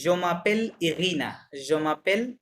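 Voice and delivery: je m'appelle Irina said slowly, twice over; slow read-aloud. Shown as a demonstration. Teacher reading the example aloud to students. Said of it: "এটা লেখা আছে কি".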